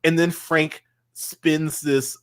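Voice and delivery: usual speaking voice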